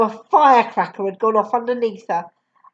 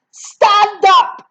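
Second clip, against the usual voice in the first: higher, louder, slow barked name; 9 semitones higher, 6 dB louder than usual. Acted. Headmistress barking at Matilda, much higher and louder. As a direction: bark it at the girl. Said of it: "she barked".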